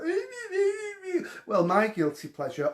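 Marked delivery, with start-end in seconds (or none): 0.1-1.3 high squealing fake cry-laugh; mock squealing sobs. Mocking gushing TV guests with a squealing fake sob. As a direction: mimic a gushing guest sobbing. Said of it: "people that come on and go".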